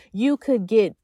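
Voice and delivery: ordinary talk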